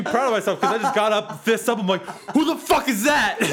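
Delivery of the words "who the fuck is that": startled shout. Retold or imitated. Startled by figure in room.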